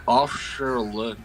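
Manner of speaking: ordinary talk, nothing marked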